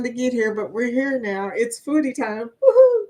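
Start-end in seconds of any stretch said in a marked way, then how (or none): 2.5-3.0 high loud whoop; high loud woohoo cheer; excited cheer. Real, not acted. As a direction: let out a big party whoop. Cheer after foodie time.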